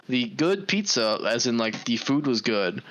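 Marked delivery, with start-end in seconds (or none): none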